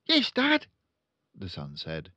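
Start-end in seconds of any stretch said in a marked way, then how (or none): 0.1-0.7 put-on high, loud boyish reply; eager son. Son answering his father, high and keen.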